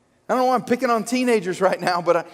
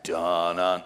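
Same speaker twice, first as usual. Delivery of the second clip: Jaws theme hum; 12 semitones lower, 3 dB quieter than usual. Shown as a demonstration. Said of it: "Jaws theme again".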